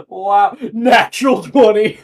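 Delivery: loud, high, drawn-out amazement; thrilled, slow and booming; excited outburst. Real, not acted. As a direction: crow over a lucky roll, loudly. Reacts to natural twenty roll.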